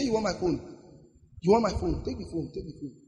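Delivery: soft, low counter-threat; unafraid victim. Acted. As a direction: hand it over with quiet, ominous confidence. Victim calmly turns threat on robber.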